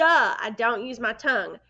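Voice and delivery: ordinary talk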